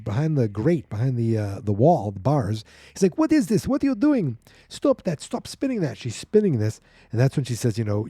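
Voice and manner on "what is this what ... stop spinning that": much higher, alarmed cries; panicked prisoner. Retold or imitated. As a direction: plead with her to stop. Prisoner panics at turning wheel.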